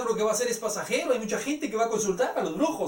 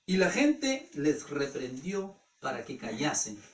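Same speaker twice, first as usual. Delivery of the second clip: ordinary delivery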